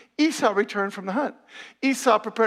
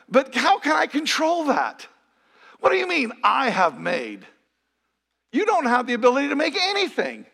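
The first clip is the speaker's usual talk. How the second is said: high, loud objection; incredulous challenge; 7 semitones higher, 4 dB louder than usual. Acted. Preacher arguing with Isaac's "I have made", loud incredulity. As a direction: challenge it loudly, drawing out the quoted claim.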